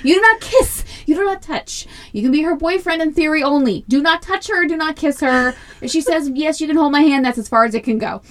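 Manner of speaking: high, emphatic mock strict rules; mock stern mom. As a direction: lay down the law half laughing.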